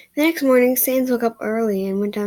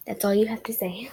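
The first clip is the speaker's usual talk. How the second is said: whispered aside. Real, not acted. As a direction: whisper it off to the side. Whispered aside after outburst.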